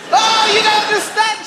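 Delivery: hammed-up wail of a wronged father; wailing dad. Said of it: "And he says".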